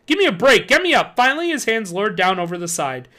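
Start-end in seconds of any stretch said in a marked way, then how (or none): 0.1-1.2 yelling. A narrator's reading. Yelling up from trapdoor.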